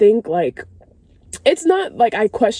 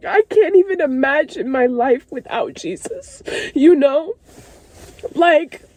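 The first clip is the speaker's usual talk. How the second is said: real crying; 6 semitones higher than usual. Real, not acted. Moved woman testifying how good Jesus is, crying. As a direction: overcome with real tears, pitch lifted. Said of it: "I'm, like, legit crying".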